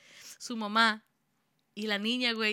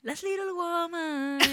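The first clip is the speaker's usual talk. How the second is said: higher, very slow dramatic title read; telenovela announcer; 7 semitones higher, much slower than usual. Pretend. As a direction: stretch it out like a soap-opera intro. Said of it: "ese sería el intro si fuera una novela".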